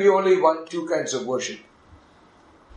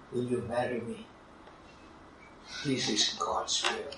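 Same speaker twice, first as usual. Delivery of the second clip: soft proposal; 5 semitones lower, 13 dB quieter than usual. Retold or imitated. Retells the man's proposal.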